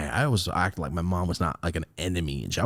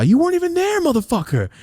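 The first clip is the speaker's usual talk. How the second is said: high-pitched, loud angry accusation; angry outburst; 4 dB louder than usual. Acted. Son confronting his absent father, angry outburst. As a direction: let it burst out high and loud at dad. Yelling at absent dad in story.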